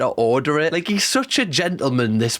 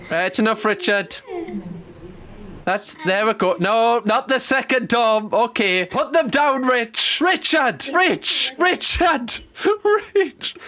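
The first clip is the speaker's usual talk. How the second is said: laughing call; 7 semitones higher than usual. Real, not acted. Laughing, calling to Richard.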